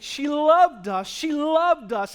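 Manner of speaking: slow, loud wailing lament; mourners' lament. Retold or imitated. Mourners weep over Tabitha, a slow loud wail. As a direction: mimic grieving friends crying it out. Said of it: "and they're all crying".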